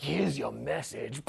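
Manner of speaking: mock sinister killer; deadpan assassin. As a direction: deliver the punchline low and cold. Assassin strikes the king.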